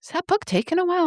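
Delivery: very high, quick needling question; imitated partner. Partner checking in at three-hour mark, high quick imitation.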